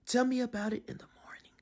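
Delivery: fast, soft unvoiced whisper; sleepy father whispering. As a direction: hush the puppy back to sleep. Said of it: "Pongo whispered".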